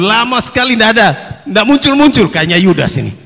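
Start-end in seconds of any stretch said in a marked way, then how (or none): none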